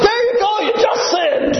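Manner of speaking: retold shout of triumph over a debate opponent; triumphant shout. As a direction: crow it out like catching him red-handed. Harold catching opponent in debate.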